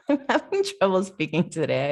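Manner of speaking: laughing at own slip-up; real self-conscious chuckle in the words; embarrassed laugh. Real, not acted. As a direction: laugh off the stumble while talking. Laughs at her own stumbles.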